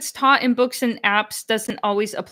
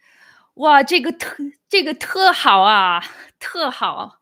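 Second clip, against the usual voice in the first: Beijing accent; 5 semitones higher, 6 dB louder than usual. Shown as a demonstration. Viewer asks for Beijing accent, he hams it up loud. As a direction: burst into a thick Beijing accent on request. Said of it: "Please do the Beijing accent".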